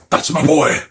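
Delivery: lightly hammed-up proud father; mock fatherly pride; proud dad Godzilla.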